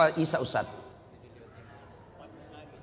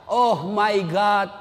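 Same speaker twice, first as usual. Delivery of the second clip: mock English prayer; 5 semitones higher, 4 dB louder, much slower than usual. Child praying in English mock.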